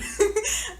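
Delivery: short high giggle; bright laugh. Real, not acted. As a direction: burst into a quick laugh. Laughs at German.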